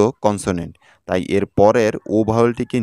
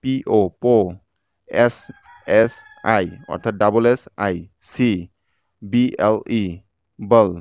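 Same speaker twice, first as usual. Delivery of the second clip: slow letters, then each syllable sounded; deliberate, segmented teacher's demo; syllable-by-syllable spelling; much slower than usual. Shown as a demonstration. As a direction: spell and sound out, pausing between chunks. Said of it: "একইভাবে দেখুন".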